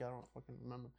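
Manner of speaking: very soft, low grumble; muttered. Retold or imitated. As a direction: grumble it under your breath. Retold exasperated reply.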